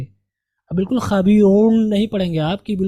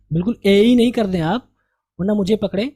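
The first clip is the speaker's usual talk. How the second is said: ordinary talk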